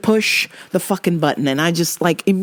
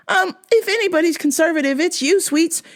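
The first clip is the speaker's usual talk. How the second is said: teasing mock; 9 semitones higher than usual. Mocking dad.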